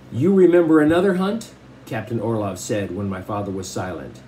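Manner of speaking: even read-aloud storytelling voice; story narration. Acted. Teacher reading a novel to the class, steady storytelling. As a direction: read the chapter to the class with care.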